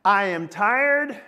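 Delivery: loud, emphatic declaration of weary resolve; Simon Peter. Acted. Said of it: "that's what Simon Peter is saying".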